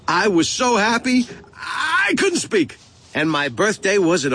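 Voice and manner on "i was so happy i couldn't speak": overjoyed. A narrator's reading. Boy overjoyed at the tickets.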